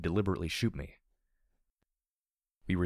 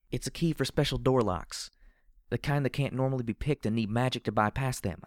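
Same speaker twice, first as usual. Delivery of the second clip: put-on higher-pitched young pony voice; young Pegasus explaining; 5 semitones higher than usual. A narrator's reading. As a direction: explain it in a high, youthful character voice. Voices Morning Glory explaining the key.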